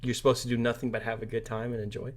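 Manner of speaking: ordinary talk, nothing marked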